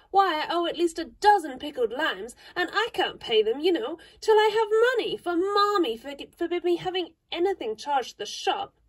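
little sister begging for sympathy; young Amy, plaintive. A narrator's reading. Amy voiced in story.

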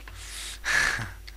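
quiet airy chuckle; soft breathy laugh. Real, not acted. Laughing at his own joke about villagers, quiet chuckle.

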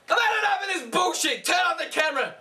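high raging yell; furious shout. Acted. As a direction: bellow at the cameraman. Repeated rant, louder.

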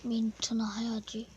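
hushed whisper. Real, not acted. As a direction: whisper it to yourself. Whispered to himself.

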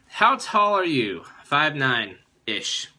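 ordinary delivery

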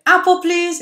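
high loud little voice; hungry Max asking for an apple; eager child. Acted. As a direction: Max begging brightly.

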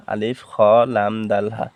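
ordinary delivery

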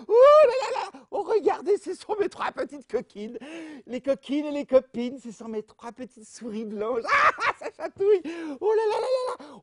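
high, loud giggly squeals; being tickled. Acted. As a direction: laugh helplessly while being tickled.

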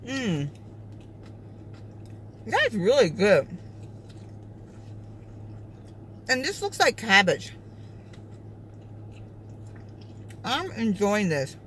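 chewing while talking; talking between bites; mouth full of noodles. Real, not acted. Seen 1: Noodles eaten at -5; food visible in chewing mouth at +2.5 and +3.2.

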